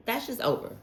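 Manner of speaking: high, breezy brush-off; dismissive. Shown as a demonstration. Telling a Libra the doubt is done, high and breezy. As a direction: toss it away lightly. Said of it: "Let's just say it like that".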